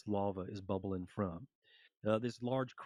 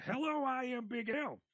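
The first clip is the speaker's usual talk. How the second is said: echoing the shout. Retold or imitated. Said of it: "And as you say".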